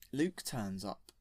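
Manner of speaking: plain reading voice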